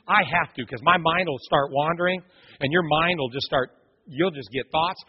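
ordinary talk, nothing marked

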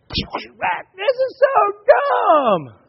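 very slow, high, drawn-out whine; whiny kid complaint. Retold or imitated. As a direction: stretch every word like a sulking child. Son still frustrated next day.

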